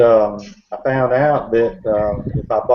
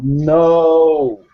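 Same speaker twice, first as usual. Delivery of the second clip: long, higher-pitched retold protest; alarmed no; 5 semitones higher, 4 dB louder than usual. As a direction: stretch it out as if trying to stop him. Teller tries to stop companion's flashlight.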